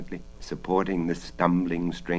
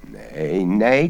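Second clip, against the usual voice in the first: cross croak; 7 dB louder than usual. Acted. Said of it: "croaked the voice crossly".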